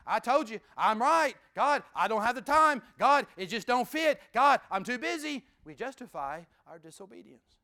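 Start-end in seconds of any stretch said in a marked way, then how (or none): 0.0-5.5 loud, insistent protest to God; defiant arguing with God. Acted. Believer loudly making excuses to God, defiant. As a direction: push back at God like a stubborn child.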